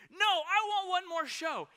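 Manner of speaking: put-on high, slow, loud child's whine; whiny toddler. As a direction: drag it out like a sulking two-year-old.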